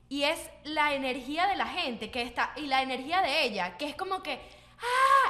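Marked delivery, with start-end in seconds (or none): 4.8-5.3 high, loud coach yell; mimicking the instructor's loud ah; imitated shout. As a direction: belt out a trainer's energetic ah. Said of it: "Y empieza a gritar".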